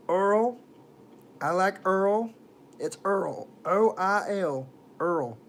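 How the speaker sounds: put-on accent, oil said as "Earl". Shown as a demonstration. Streamer joking about oil, stupid put-on accent. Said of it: "I was doing a stupid accent".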